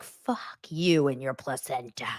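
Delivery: slow, low, flat dismissive; quoting her own retort to the mom, slow and low; deadpan retort. Retold or imitated. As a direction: drag it out, dead serious. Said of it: "I was like".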